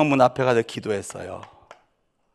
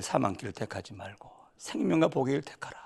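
whisper; 8 dB quieter than usual. Real, not acted. Whispered exhortation in sermon.

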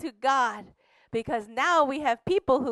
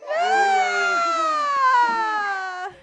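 long, very high, slow shouted hallelujah; jubilant cry; 12 semitones higher, 5 dB louder, much slower than usual. Acted. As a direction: cry out in joyous triumph. Missionary celebrates God's victory.

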